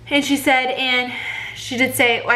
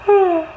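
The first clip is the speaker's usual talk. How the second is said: heavy sigh; 9 semitones higher, 5 dB louder than usual. Real, not acted. Undressing for ultrasound.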